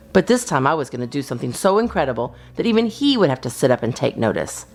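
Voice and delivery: plain reading voice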